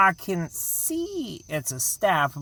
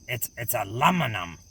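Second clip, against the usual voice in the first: usual speaking voice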